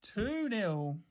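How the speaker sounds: soft, stretched call; lightly voiced kid calling the score; understated call-out. A narrator's reading. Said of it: "called out one kid".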